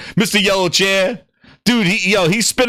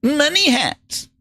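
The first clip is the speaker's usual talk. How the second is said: slower, higher whiny money hat; mock crying fan; 8 semitones higher, slower than usual. Streamer mocks fans who cry about money hats, slow and whiny. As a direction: whine it like a sore fan. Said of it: "Nobody was crying".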